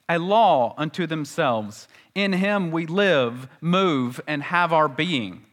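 slow, solemn reading of scripture; measured, reverent King James recital. Acted. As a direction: intone the old Bible phrases with reverence. Quotes scripture phrases.

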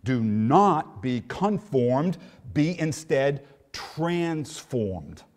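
slow, each word set apart; slow emphatic contrast. Shown as a demonstration. Pastor spells out the instead contrast, slow and deliberate. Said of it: "emphatic contrast of instead".

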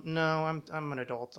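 ordinary delivery

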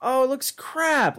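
high, slow, loud disgusted rant; outraged rant. Real, not acted. Host ranting to co-host about a rival's logo, outraged. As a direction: wail at how bad the logo is.

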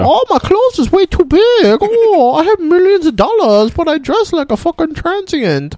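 high whiny mock voice; mocking Adam Sandler. Friend mocks Adam Sandler, high and whiny. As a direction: whine it up high. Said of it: "And he's like".

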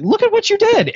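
high-pitched hammed-up scolding; squeaky mock dismay. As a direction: play the goofy sidekick surveying the damage. Biff berating at crime scene.

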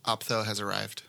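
bored, toneless consultant on the phone; deadpan, no interest at all; flat monotone voice. Acted. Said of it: "Did you like my flat monotone voice?".

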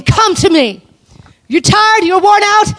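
high, loud, insistent call; urgent invitation. Acted. Preacher voicing Jesus calling the worn out, urgent high invitation. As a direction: call them over with excited urgency. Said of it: "I realized that he's probably saying".

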